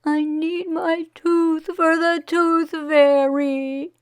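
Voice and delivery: put-on high, slow tearful child voice; boy crying to his parents, high and slow. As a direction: whimper it out through tears. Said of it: "Wei began to cry".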